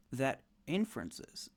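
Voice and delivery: ordinary delivery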